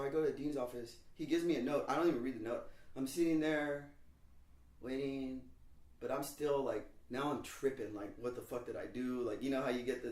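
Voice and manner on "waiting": usual speaking voice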